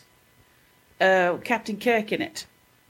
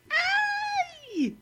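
very high, drawn-out comic cry; mock cry of a bungling superhero; cartoon yelp; 5 dB quieter than usual. Acting out superhero's gadget mishap.

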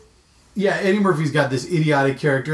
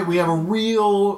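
stretched, higher stress on "real"; sarcastic emphasis; 5 semitones higher, slower than usual. Real, not acted. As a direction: draw out "real" as if in air quotes. Air quote on the word real.

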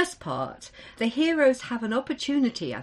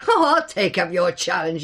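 grinning; 3 semitones lower, 6 dB louder than usual. Acted. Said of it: "he grinned".

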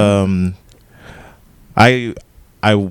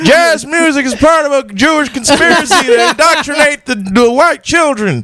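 conspiracy rant voice; 10 semitones higher than usual. Retold or imitated.

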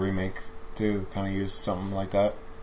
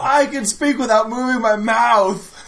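mock character voice; 12 semitones higher, 6 dB louder, slower than usual. Mocking the character on screen.

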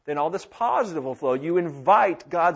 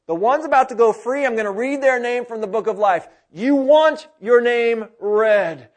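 proclaiming Jesus; 7 semitones higher, 11 dB louder than usual. Acted. Preacher voices Jesus proclaiming.

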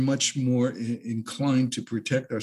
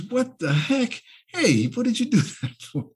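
much higher, louder outrage with a laugh; shocked frog; 12 semitones higher, 4 dB louder than usual. Acted. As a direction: yelp it like you've just been stung. Frog voiced after being stung.

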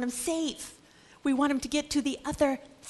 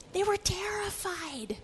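soft, slow and breathless; hushed storyteller conveying fear; whispered dread; 7 semitones higher, 6 dB quieter than usual. A narrator's reading. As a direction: whisper it as if afraid to be heard.

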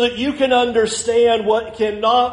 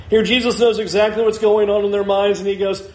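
normal talking voice

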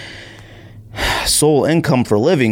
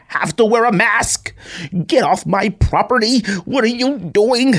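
shrill-pitched entitled Karen, much higher; mock Karen; 11 semitones higher than usual. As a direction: screech it like an indignant Karen. Mocking a Karen.

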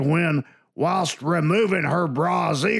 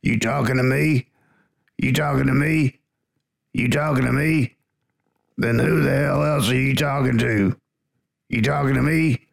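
low menacing movie tough guy; tough guy impression; 4 semitones lower, 3 dB louder than usual. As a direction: lean in like a cornered gangster. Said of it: "Here you go".

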